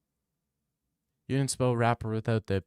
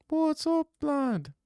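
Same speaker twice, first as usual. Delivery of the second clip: much higher squeaky greeting voice; high mock greeting; much slower than usual. Greeting the chat in a high put-on voice. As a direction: pitch it way up for a jokey hello.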